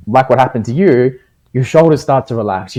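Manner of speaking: ordinary talk, nothing marked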